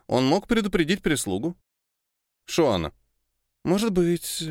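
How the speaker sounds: ordinary delivery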